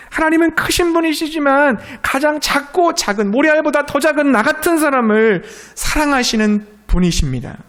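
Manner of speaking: building sermon fervor; fervent preaching. Real, not acted. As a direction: preach it out with conviction. Preaching at full force.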